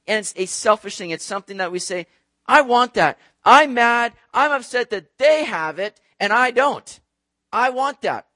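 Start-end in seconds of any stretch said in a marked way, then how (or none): 2.5-8.3 loud, higher, petulant demand; mock indignant grievance, punched out; jealous complaint. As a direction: act out a selfish, envious tantrum. Acting out selfish jealous complaint.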